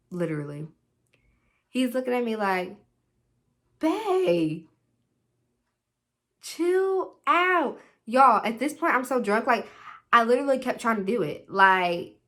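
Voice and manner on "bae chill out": high, drawn-out "calm down" voice; boyfriend's plea to chill. Retold or imitated. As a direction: imitate a boyfriend gently reining her in. Boyfriend hushing her in the club.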